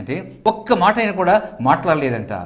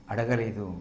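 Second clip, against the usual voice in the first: softer, dropped echo of అడగలేదు; 8 semitones lower, 10 dB quieter than usual. Shown as a demonstration. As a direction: let అడగలేదు fall away softly. Softer echo of previous line. (మెల్లగా అడగలేదు)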